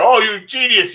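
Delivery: high, loud mock admiration; gushing fan. Author imitating readers who think he's a genius, gushing. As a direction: gush like an awestruck reader. Voicing gushing fans of his book.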